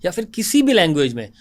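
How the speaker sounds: soft, even promo narration; podcast voiceover intro. Acted.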